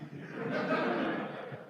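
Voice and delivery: imitated high breathy giggle; villagers' laughter. Priest imitating the mothers laughing at his phrase, high giggle. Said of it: "the response every time I used that was".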